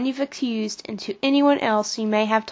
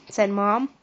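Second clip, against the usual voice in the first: ordinary delivery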